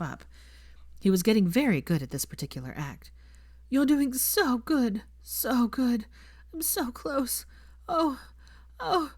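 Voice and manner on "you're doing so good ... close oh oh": rising higher, slow and panting; breathless moans. A narrator's reading. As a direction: build toward climax, moaning. Climax in progress.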